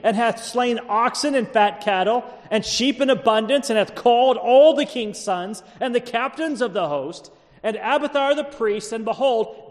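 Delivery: declaimed Bible passage; dramatic scripture reading. A narrator's reading. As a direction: proclaim the verse aloud in a high, ringing pulpit voice. Reads scripture aloud to congregation.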